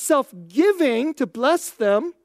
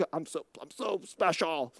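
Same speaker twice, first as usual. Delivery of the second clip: mock smug self-admiration; 8 semitones lower, 11 dB quieter than usual. Mocking everyone's self-regard.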